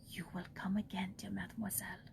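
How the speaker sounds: hushed whispered farewell. A narrator's reading. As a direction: whisper it gently at the door. Nun's soft goodbye at door.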